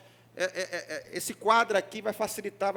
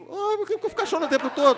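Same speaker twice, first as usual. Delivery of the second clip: higher, laughing mock complaint; 10 semitones higher, 7 dB louder, faster than usual. Pastor mocking a member who complains about laughing, higher and laughing.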